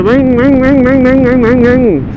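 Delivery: high ring-ring of a weedy two-stroke; imitating a small engine. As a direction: mimic a tinny little engine revving. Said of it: "the poor little monkey bike".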